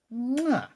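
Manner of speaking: loud high kiss sound; chef's kiss smack. Real, not acted. Praising the ending's song with a loud high chef's kiss. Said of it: "Chef kiss for this ending".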